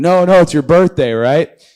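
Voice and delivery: loud, high, pushy no-no; a buddy loudly overruling the protest; insistent shout. Retold or imitated. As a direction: shout him down, cheerfully insisting. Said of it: "It's like".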